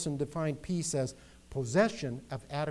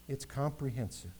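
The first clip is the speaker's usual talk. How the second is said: normal talking voice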